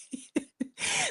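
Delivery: high squealing laugh; high giggle. Real, not acted. Telling viewers the funny part, high giggle. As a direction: let a high giggle slip out.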